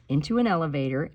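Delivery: usual speaking voice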